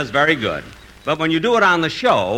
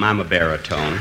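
drops way down low to sound like a baritone; mock deep baritone; 11 semitones lower than usual. [name] dropping his voice low to sound manly. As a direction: prove you're a baritone, go low.

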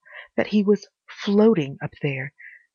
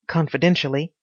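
plain reading voice

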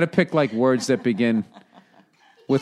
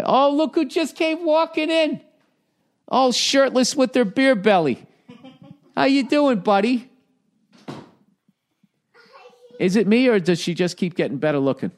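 put-on cooing to his baby daughter in high baby talk; 13 semitones higher than usual. Greeting the baby.

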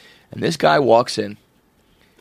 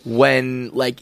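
ordinary talk, nothing marked

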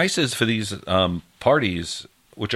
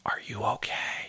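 hushed re-enacted whisper, barely any voice; very quiet; 10 dB quieter than usual. Partner checking on someone resting after flogging, very quiet. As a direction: whisper it softly, as a gentle check-in. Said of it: "I very quietly said".

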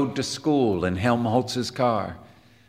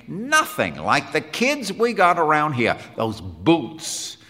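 louder, higher voice, baffled complaint; emphatic, raised-pitch disbelief; wondering Quinn; 7 semitones higher, 5 dB louder than usual. Acted. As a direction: grumble in amazement about a strange kid. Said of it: "said Quinn wonderingly".

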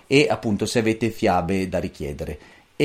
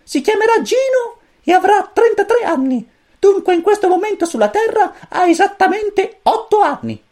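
very high, squeaky little chick voice; cosmic chick voice; 6 dB louder than usual. A narrator's reading. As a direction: chirp it like a tiny alien chick. Pulcino cosmico parla. (vocina acutissima da pulcino)

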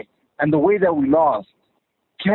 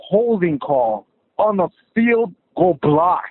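ordinary delivery

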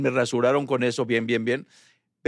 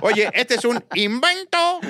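high, slow evil inventor; mad scientist; 6 semitones higher, much slower than usual. Pretend. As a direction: cackle it like a mad doctor. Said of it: "laboratorios del doctor Chunga".

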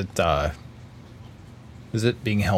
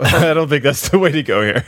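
laughing; 7 semitones higher, 7 dB louder, faster than usual. Real, not acted. Player laughing at blade's joke.

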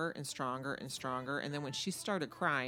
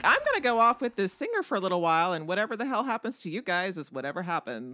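mock carefree; 3 semitones higher, 7 dB louder than usual. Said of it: "He's like".

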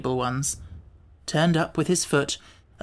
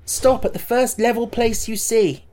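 put-on higher boy giving an order; commanding boy; 7 semitones higher, 3 dB louder than usual. A narrator's reading. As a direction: command the Gump to stop.

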